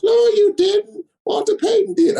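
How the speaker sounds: mocking the GM's high, slow nasal voice; high mock nasal voice of the general manager; nasally John. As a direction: imitate the boss, high and nasal. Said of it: "John has that nasally voice".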